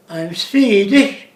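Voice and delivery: mock Swedish lilt, stretched out; drawn-out Swedish accent. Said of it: "Swedish speak like that".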